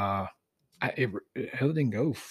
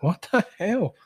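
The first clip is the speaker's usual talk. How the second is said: high-pitched, mock-disbelieving retort; incredulous husband; 10 semitones higher, slower than usual. Husband hearing his wife's demand for a tracker, imitated incredulity.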